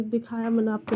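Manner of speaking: usual speaking voice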